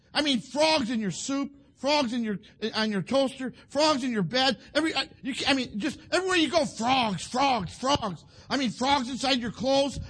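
worked-up listing. Acted. Preacher pictures frogs everywhere for the kids, worked up and animated. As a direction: pile it up as if overrun, hammed-up. Comically piles up places frogs invade.

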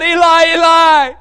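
preacher voicing Jesus crying out from the cross. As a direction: call it out in agony. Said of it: "And he cried out".

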